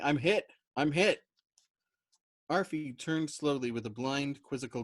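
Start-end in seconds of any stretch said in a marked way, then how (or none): 0.0-1.2 loud, urgent repeated cry; wounded airman pleading, close to tears; near-tearful cry. Acted. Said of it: "almost weeping".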